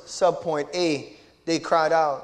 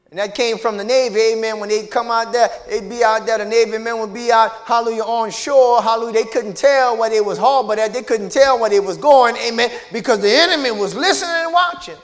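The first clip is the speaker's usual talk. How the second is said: loud, higher, driving sermon delivery; full-force preaching; 4 semitones higher, 7 dB louder than usual. Real, not acted. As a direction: preach it hard, riding over the congregation.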